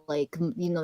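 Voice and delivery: ordinary talk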